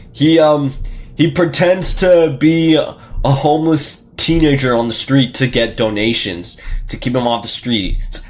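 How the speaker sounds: ordinary talk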